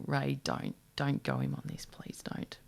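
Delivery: imitated pleading, dropping into a whisper; very soft aside, fading to a whisper; hushed pleading. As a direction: plead under your breath, as if warning someone off. Acts out Mark Brown pleading aside.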